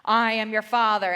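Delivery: very loud, booming villain declaration; Darth Vader. Retold or imitated. Husband to son mid-lightsaber fight, booming Darth Vader. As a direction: boom it out like the movie villain. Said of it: "my husband says".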